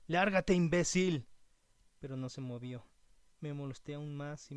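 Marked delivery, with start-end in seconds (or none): 0.1-1.3 loud, high, drawn-out yell; angry shout. A narrator's reading. Camper ordering the intruder away from his tent, angry shout. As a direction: shout it slowly at full force to drive someone off. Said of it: "arrojando mi cobija grité".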